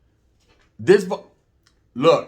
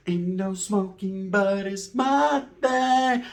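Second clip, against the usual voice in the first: high, very slow drawled line; lazy mock cellmate; 4 semitones higher, much slower than usual. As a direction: drag it out like a lazy inmate. Mocking cellmates' talk.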